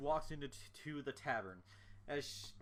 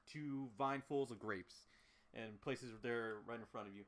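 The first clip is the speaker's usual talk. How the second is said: ordinary delivery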